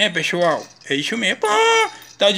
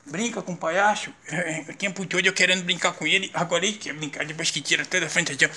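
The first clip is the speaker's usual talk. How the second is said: hushed coaxing; 3 semitones lower, 7 dB quieter than usual. Acted. Dad quietly coaxing upset baby.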